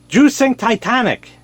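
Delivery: deadpan jab in a put-on voice; mock joke character. Chinese guy's retort in joke.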